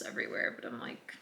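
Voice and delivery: soft unvoiced whisper. Real, not acted. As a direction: drop to a whisper for the aside. Whispered aside about wedding stuff.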